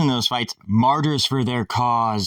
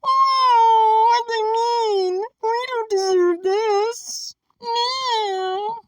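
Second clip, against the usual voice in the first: very high mock crying voice; mocking whiny victim; 3 dB louder, much slower than usual. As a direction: wail in an exaggerated squeaky victim voice. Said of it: "That's what he sounds like".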